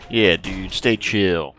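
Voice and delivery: loud lazy surfer-dude echo; mock surfer dude. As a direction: parrot the stoner line back, loud. Echoing the NPC's surfer line.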